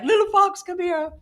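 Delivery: high-pitched coo to a little animal; coaxing animal call. Pretend. Owner calling a wild fox like a pet, high-pitched coaxing. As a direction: call the critter over in a tiny high voice.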